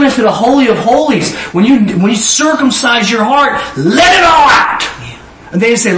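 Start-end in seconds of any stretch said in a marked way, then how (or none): 3.8-4.8 higher, louder, slowed call; preaching shout. Acted. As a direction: urge them to let go, loudly. Preacher urging release.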